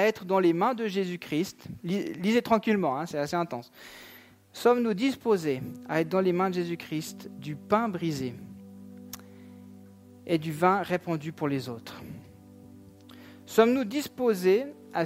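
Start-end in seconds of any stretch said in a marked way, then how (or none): none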